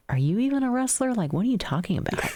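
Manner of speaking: quick, high-pitched challenge; incredulous retort. Retold or imitated. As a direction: fire back in disbelief at an unqualified critic. Retold retort to criticizing husband.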